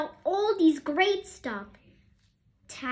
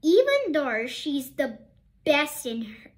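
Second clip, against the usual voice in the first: plain reading voice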